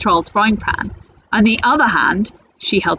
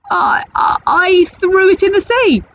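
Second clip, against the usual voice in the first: stammering fright; 11 semitones higher, 3 dB louder than usual. Acted.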